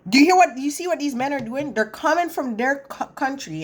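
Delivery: man ranting about foreign men, imitated heat; retold heated rant. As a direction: play his furious yelling back. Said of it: "I'm heated".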